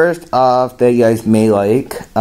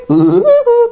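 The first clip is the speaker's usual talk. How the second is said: very high staccato cartoon cackle; louder high rat-a-tat bird laugh; rat-a-tat woodpecker; 5 dB louder than usual. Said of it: "Woody Woodpecker's gonna say something".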